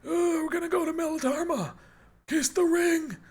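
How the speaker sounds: put-on high, wobbly old miner voice; a failed Godfather that turns into an old prospector. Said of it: "I just sound like an old miner".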